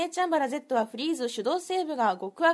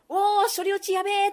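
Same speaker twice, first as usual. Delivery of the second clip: mock exclaim; 6 semitones higher, 5 dB louder than usual. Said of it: "って思いましたね".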